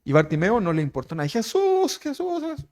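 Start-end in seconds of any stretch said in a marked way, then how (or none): 1.3-2.7 very high, thinned-out repeated call; persistent calling. Acted. Bartimeo ignores the crowd, keeps calling in high voice. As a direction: keep calling the name, high and fading away.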